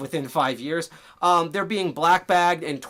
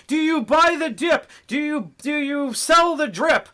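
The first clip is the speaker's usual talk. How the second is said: high, loud hammed-up finance bro; mock crypto investor; 11 semitones higher, 7 dB louder than usual. Mocking crypto investor hype for his viewers, high and loud. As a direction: squeal the hype like a pushy investor. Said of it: "a ton of investor stuff".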